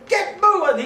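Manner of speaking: high, loud bark, laughing; shouted order. Retold or imitated. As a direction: yell it at the prisoner.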